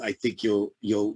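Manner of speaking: normal talking voice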